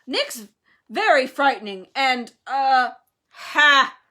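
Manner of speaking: loud, faltering interjection; yelling. A narrator's reading. Harry sticking up for Nick, loud and halting. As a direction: blurt it out, groping for words.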